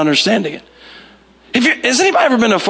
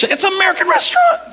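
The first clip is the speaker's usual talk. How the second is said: high-pitched comic protest; hammed-up outraged tourist; mock indignant outburst; 12 semitones higher than usual. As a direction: cry out in exaggerated indignation. Mock indignant outburst about ordering English.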